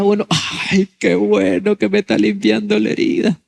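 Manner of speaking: put-on high, blissful patient; high-pitched mock delight; mock contentment. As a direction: pretend to relish the treatment. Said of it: "No, está pegando gritos".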